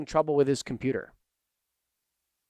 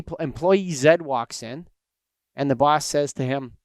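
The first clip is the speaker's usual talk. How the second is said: usual speaking voice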